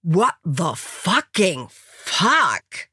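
very slow, drawn-out swearing; dumbfounded. A narrator's reading. American stunned to see Angela, slow dumbfounded exclamation. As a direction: stretch each word in utter disbelief.

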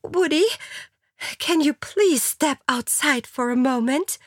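high-pitched polite request; pleading mistress. A narrator's reading. Alexa asking her lover to step outside, high polite plea. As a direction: ask sweetly in a high voice. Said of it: "bat ihren Liebhaber".

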